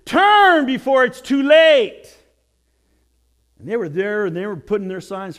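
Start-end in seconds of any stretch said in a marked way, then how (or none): 0.0-2.2 loud, slow, urgent warning. Retold or imitated. Rabbi's sign read out, loud slow warning. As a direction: call it out to passing cars. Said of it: "a sign that says".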